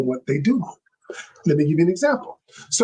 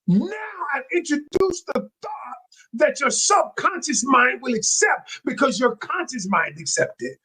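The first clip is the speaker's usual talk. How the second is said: excited, higher-pitched teaching; getting hyped; 6 semitones higher than usual. Real, not acted. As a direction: get carried away by your own point. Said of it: "I'm getting hyped".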